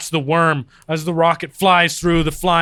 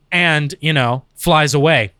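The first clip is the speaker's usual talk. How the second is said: normal talking voice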